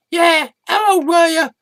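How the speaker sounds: very high, prying question; nosy squeaky echo. Acted. Sidekick echoes the interviewer's question, high-pitched and nosy.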